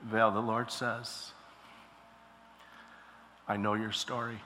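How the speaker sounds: hushed prophetic prayer voice. Acted. Prophetic prayer over Val.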